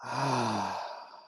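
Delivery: long, falling "ahh" sigh; relaxed demonstrated sigh with voice; voiced sigh. Shown as a demonstration. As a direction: sigh aloud to let it all go. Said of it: "You could even sigh".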